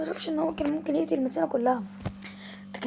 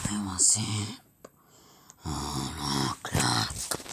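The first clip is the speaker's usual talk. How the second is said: very low, slow growling monster; 5 dB quieter, much slower than usual. Acted. Monster threatening to eat the boy, deep and slow. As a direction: loom over the boy as a menacing beast.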